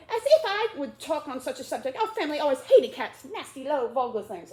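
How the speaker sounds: loud, high angry mouse; indignant rant. Acted. Mouse ranting to Alice about cats, indignant and loud.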